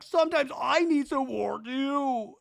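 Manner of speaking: high, hammed-up drunken whine; drunk Zach. Drunk Zach begging Brittany for support, high mock wail. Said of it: "Zach's so drunk".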